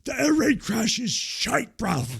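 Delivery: high, loud mock British, bruv and all; imitating Ospreay, hammed-up; British accent impression. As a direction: play up a London lad voice. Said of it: "and he was like".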